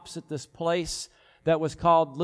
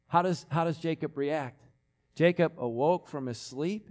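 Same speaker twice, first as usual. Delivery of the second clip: normal talking voice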